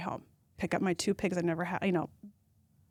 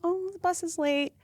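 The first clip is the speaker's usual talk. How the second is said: much higher, sugary mock small talk; fake friendly mom; 9 semitones higher than usual. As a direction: put on a chirpy school-mom greeting. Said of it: "I should just go".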